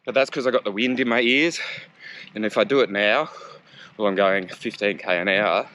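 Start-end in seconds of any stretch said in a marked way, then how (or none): none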